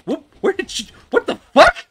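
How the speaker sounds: high, loud shocked yelp; loud, high-pitched panic; startled shout. Real, not acted. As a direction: burst out in real alarm, voice shooting up. Startled by something in the game.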